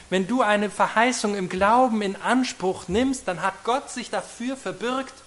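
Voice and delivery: ordinary delivery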